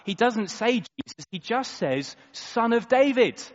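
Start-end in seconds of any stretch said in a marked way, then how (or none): 2.3-3.4 calling out. Acted. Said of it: "the blind man calls out".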